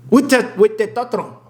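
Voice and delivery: testosterone in a put-on Spanish accent, s sounds dropped. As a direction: say "testosterone" as "teh-tah-tron", Spanish-style. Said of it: "Why do I have a Spanish accent".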